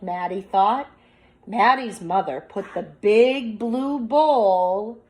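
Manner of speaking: slow, expressive picture-book reading; reading aloud to children. Acted. Teacher reading a picture book aloud to her class, slow and expressive. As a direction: draw the class into the story.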